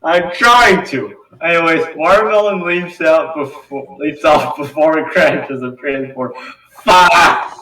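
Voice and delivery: loud, high, strained yelling over the chaos; frantic shouting. Real, not acted. Narrator yelling while struggling to keep up. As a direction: yell it out, overwhelmed.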